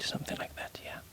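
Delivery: usual speaking voice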